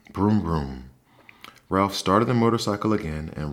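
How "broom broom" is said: engine noise. A narrator's reading. Mouse makes motorcycle noise.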